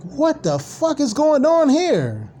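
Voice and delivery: high, drawn-out baffled reaction to the chat; mock disbelief. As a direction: stare at it in total disbelief. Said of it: "I'm just watching this like".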